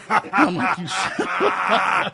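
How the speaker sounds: laughing through the words; slow laughing half-curse. Real, not acted. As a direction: break up mid-insult. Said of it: "laughing through it".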